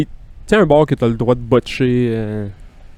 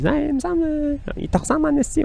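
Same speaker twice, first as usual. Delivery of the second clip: hospital coworker; 5 dB quieter than usual. Retold or imitated. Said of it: "du monde aussi à l'hôpital qui me le dit".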